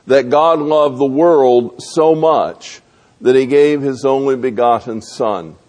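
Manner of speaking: ordinary talk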